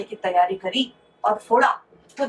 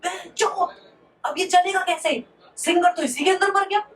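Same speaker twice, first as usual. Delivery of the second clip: pitched-up joke shout at a dead radio; mock yell; 7 semitones higher than usual. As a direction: yelp it out high as a shocked Sardar. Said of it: "सरदार जोर से चिल्लाया".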